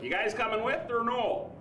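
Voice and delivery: high, slow Wisconsin drawl ending on "or no"; hammed-up Wisconsin accent, pitched high; broad Wisconsin accent. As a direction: imitate Wisconsin buddies, accent stretched out. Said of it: "what I call the er no factor".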